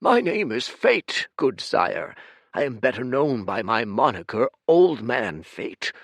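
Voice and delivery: bow with your voice, polite and proper; courtly old man. Acted. Old Fate politely introducing himself to the giant, humble courtly voice. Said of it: "he said".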